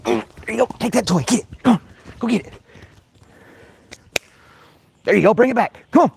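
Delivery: high excited voice for the dog; squeaky playful fetch calls; high-pitched dog talk. Real, not acted.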